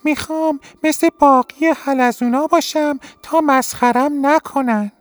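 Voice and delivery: a tiny winged snail speaking up proudly. Acted. (صدای خیلی زیر و نازک یک حلزون بالدار)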